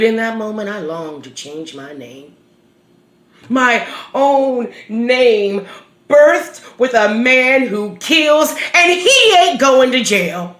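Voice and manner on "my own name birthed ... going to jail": anguished outrage. Acted. Mother rages at officer who shares her name, anguished. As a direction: build to a bitter, outraged outcry.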